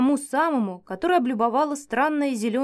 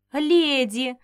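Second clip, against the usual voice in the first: delighted exclaim; 3 semitones higher, 3 dB louder than usual. Acted. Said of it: "всплеснула руками огромная женщина".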